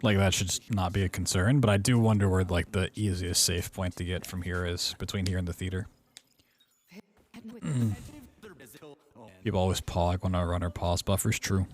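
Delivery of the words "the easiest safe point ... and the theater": ordinary talk, nothing marked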